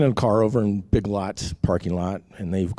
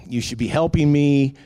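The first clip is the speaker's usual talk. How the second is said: raised, accusing retold complaint; retold screaming at God; 5 semitones higher, 4 dB louder than usual. Man in his car scolds God, retold yell. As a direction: reproach God in a half-raised voice. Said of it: "I'm just screaming at him".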